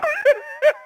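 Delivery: high, loud burst of laughter. Real, not acted. Host cracking up at his own punchline, loud.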